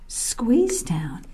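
ordinary delivery, nothing marked

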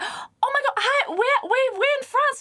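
very high, gushing impression; mimicked excitement. Rugby player greeting fellow French speakers, mock excited squeal. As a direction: imitate an over-eager would-be friend. Retelling man's eager friendly reaction.

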